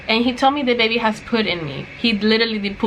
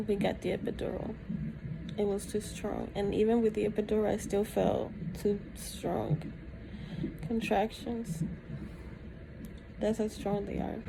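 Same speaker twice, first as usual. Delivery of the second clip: tired, soft; 14 dB quieter than usual. Real, not acted. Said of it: "tired in labor bed".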